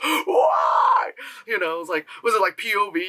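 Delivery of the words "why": high, hammed-up wailing "why"; imitating overacted sobbing; mock anguished cry. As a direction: cry out in fake, over-the-top grief. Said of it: "totally that guy on TikTok".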